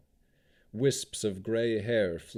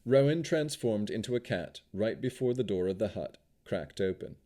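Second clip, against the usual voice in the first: usual speaking voice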